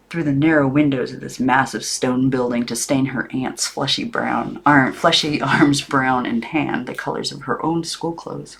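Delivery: usual speaking voice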